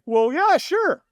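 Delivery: hammed-up carefree tone; mock casual agreement. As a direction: shrug it off like it's nothing. He mock-agrees to just watch.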